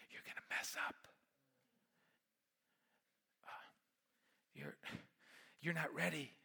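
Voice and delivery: hushed whisper of the doubts in his head; whispered doubting thoughts. Retold or imitated. Pastor voicing the anxious thoughts before the presentation, whispered. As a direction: whisper it like a nagging inner voice. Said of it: "hearing thoughts like".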